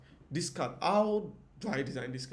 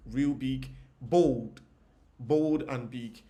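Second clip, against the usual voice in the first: punchy emphasis; 8 dB louder, much slower than usual. Shown as a demonstration. Teacher emphasizes header size.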